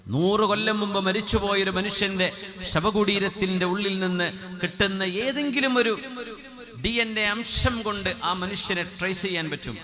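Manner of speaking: fiery sermon peak; full-force preaching. Acted. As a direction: drive the point home from the pulpit. Preacher builds wonder about DNA.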